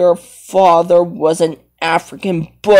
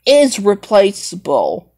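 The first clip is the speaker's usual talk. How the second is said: ordinary talk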